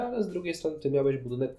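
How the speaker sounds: normal talking voice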